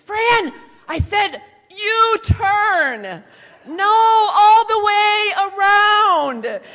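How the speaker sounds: very loud, high, drawn-out yell; screaming dad. Retold or imitated. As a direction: bellow driving orders, stretching the words. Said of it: "all of a sudden he screams".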